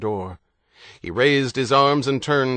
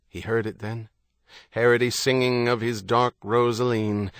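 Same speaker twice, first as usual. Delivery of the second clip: plain reading voice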